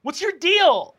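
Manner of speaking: high, loud, exasperated yell; drawn-out, high-pitched outburst; angry character shout. Acted. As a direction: yell at a pest to back off. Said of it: "she says".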